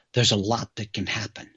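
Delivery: normal talking voice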